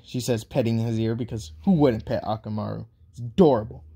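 usual speaking voice

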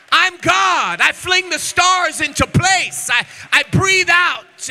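voicing God, the preacher roars at the crowd; preaching at full force. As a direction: build into a fiery climax as God speaking. Preaching God's words at full force.

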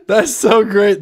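high squealing laughter through words; cracking up while talking; laughing hard. Real, not acted. Laughing hard at Punch's drawing.

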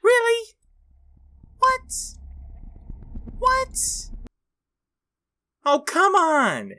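very high, loud shrieks of rage; screeching in gamer frustration; rage screaming. Real, not acted. Raging at game failure.

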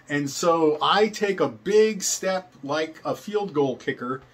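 ordinary talk, nothing marked

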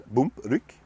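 plain reading voice

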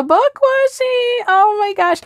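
very high-pitched excited squeal; thrilled, giddy outburst; freaking out. Real, not acted. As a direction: squeal with sudden delight on spotting it. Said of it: "I'm sorry for freaking out".

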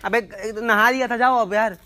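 high, loud complaint; annoyed protest. Real, not acted. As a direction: send them off in loud exasperation. Annoyed, sending her away.

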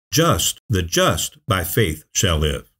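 "Just" said alone first, then slow with "just" punched; slow, deliberate phrase, hammering "just" twice; stress on "just". Shown as a demonstration. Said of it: "he emphasizes the word just".

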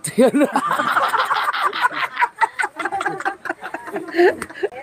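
long, high-pitched laugh; real laughing fit. Real, not acted. Joking with friends about coconut, real laughing fit. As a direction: crack up and keep laughing.

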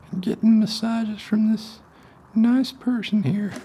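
very high, loud squeaky frog voice; little frog voice. Acted. Little frog chattering to the person giving it massages. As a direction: squeak it out like a tiny happy frog.

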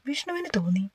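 ordinary delivery

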